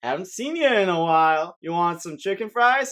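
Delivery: loud, swooping tempter's call; Burger King king. Acted. Mascot tempting Tom from an alleyway, loud coaxing call. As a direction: lure an old customer, calling out loudly. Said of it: "the Burger King king will poke his head out the corner and be like".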